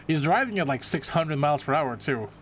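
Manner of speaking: usual speaking voice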